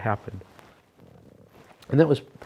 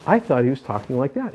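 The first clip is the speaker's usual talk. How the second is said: ordinary delivery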